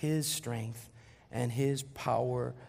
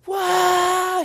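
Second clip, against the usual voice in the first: high, loud imitated scream of joy; 11 dB louder than usual. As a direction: scream it like an overjoyed wife. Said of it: "She's screaming out".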